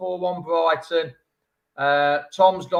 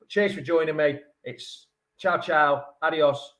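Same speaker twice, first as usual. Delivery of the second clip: ordinary talk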